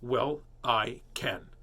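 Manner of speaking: very slow, a full stop after each word; mad mum. Shown as a demonstration. Reader shows how mums sound mad, slow and clipped. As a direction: spell out every word like an angry mother. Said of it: "that's how you tell mums are mad".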